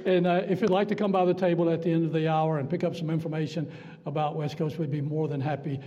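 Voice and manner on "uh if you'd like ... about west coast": normal talking voice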